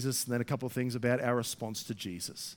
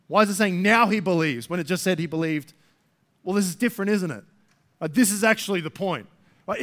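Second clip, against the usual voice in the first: loud, driving preacher voice; forceful preaching; 5 semitones higher, 10 dB louder than usual. Acted.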